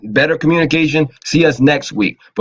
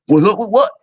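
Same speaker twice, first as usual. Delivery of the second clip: suspicious retort, pitched higher; mock suspicious; 8 semitones higher than usual. Mocking someone who takes a greeting badly, suspicious. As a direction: throw it back like an accusation. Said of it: "three times".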